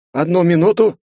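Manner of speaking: high, courteous apology; a rector excusing himself; polite rector. A narrator's reading.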